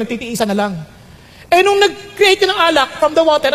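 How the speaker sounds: high, animated preaching voice; fervent preaching. Acted. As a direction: preach with rising fervour to the congregation.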